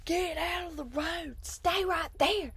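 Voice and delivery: high, strained mock dad yell; imitating yelling dad.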